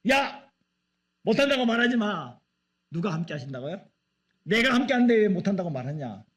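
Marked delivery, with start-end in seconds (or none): 0.1-2.4 sharp, loud, high rebuke; angry God. Retold or imitated. God scolding Moses for saying he can't, loud and angry. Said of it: "하나님이 그때는 화가 났어요". 4.4-6.2 loud, higher scolding question; angry God. Retold or imitated. God rebuking a doubting Moses, loud scolding. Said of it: "화가 났어요".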